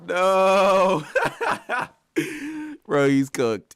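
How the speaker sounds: long, high, loud wail breaking into laughter; loud dismay. Real, not acted. Streamer hearing chatter's confession, loud amused dismay. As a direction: cry it out in amused horror. Hears chatter talks so as joke.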